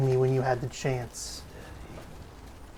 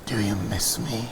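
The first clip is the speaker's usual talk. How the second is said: 1 low spooky demon voice; 7 semitones lower, 4 dB quieter than usual. Pretend. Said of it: "Why are you talking like that?".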